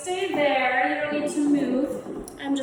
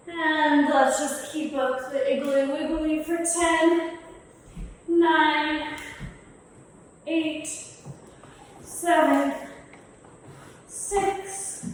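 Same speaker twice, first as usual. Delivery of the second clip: building countdown; 5 semitones higher, 5 dB louder, much slower than usual. Acted. Counting down the wiggle exercise.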